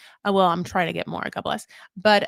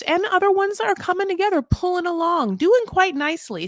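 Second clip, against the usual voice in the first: chirpy; 11 semitones higher than usual. Real, not acted. Upbeat aside on good couples.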